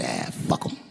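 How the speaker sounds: dismissive. Retold or imitated. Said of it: "and I said".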